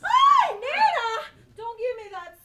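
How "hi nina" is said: high, loud startled cry; startled shriek. Acted. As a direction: yelp it out in fright.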